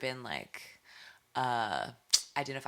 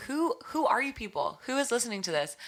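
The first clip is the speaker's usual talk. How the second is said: higher-pitched, disbelieving questions; freaking out; 9 semitones higher than usual. Retold or imitated. Artist recalling shock at her streaming numbers, freaking out. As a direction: act out being freaked out by sudden attention. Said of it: "I was freaking out".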